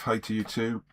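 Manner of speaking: ordinary delivery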